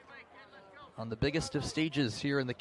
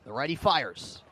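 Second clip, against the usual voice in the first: normal talking voice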